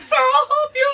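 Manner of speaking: high, loud, laughing panicky rescuer; panicked helper. Retold or imitated. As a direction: squeal it out like a frantic man trying to help, half laughing. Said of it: "This poor man was just like".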